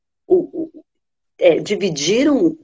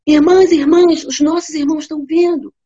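much higher, loud calls; desperate call; 8 semitones higher, 6 dB louder than usual. Acted. The wife desperately calling to her sisters, much higher.

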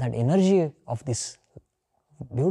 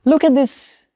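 calling out; 10 semitones higher, 7 dB louder, slower than usual. Real, not acted. Teacher calls out for attention.

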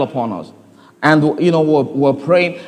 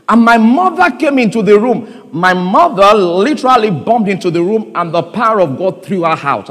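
usual speaking voice

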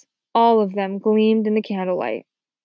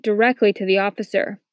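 plain reading voice

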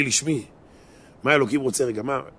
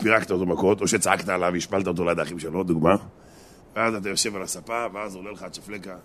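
ordinary delivery

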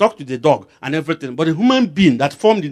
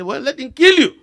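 provocative answer; 10 semitones higher, 4 dB louder than usual. Retold or imitated. Preacher's startling answer.

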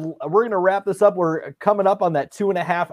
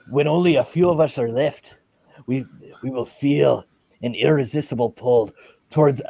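put-on Scottish accent. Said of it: "Highlander quotes".

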